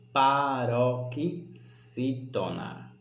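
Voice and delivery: "paroxítona" very slowly in separated chunks, falling; stretched term. Shown as a demonstration. Teacher giving the classification, slow and segmented. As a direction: draw out "paroxítona" syllable by syllable. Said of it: "Essa sílaba vai se chamar".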